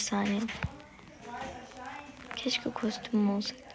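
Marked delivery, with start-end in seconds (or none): 2.4-3.6 soft unvoiced wistful whisper; whispered wish. Real, not acted.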